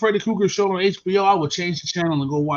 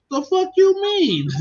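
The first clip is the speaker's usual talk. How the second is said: incredulous; 12 semitones higher, slower than usual. Real, not acted. Indignant about the movie.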